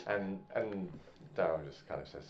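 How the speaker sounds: ordinary delivery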